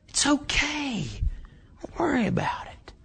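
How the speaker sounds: hissed, high whisper of a tempter; devil's whisper. Acted. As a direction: whisper it like the devil coaxing you. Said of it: "he'll say".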